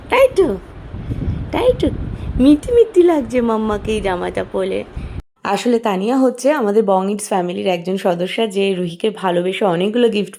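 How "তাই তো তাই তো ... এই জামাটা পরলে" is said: sing-song doting mother talk. Real, not acted. Cooing to baby about her dress.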